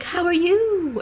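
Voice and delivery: a strange high greeting to throw her off; weird voice. Retold or imitated. Greeting the angry sister in a weird high voice. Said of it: "just purposely talking really weird".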